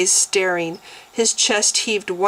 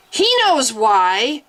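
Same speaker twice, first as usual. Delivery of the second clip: raging; 5 semitones higher, 4 dB louder, slower than usual. A narrator's reading. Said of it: "she raged fiercely".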